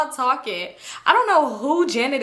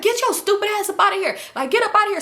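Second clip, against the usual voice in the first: fast, high-pitched dismissal; imitated group outrage; acted telling-off; 10 semitones higher, faster than usual. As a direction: send him packing in a mock yell. Group tells him off, acted.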